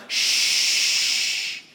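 long drawn-out shush. Retold or imitated. Preacher imagining Caleb silencing the people, long shush. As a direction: hush a crowd with one long "shh". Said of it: "I don't know if he just went".

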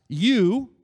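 YOU punched high and very loud; all caps emphasis. Shown as a demonstration. Preacher showing the original language's emphasis, YOU in all caps. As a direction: hit the word YOU as if in capitals. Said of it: "all caps".

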